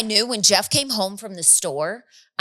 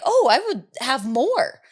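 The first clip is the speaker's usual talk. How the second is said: high, happy enthusiasm; 6 semitones higher than usual. Retold or imitated. Quoting her enthusiasm for soup.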